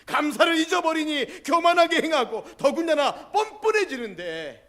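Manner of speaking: loud, high, ringing sermon voice; full-force preaching. Real, not acted. Pastor challenges the congregation about answered prayer, loud preaching. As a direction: preach it out to the whole hall.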